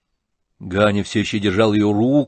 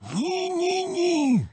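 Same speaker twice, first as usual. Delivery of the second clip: frightened whisper; much slower than usual. Acted. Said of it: "зашептал ... с страшно испуганным видом".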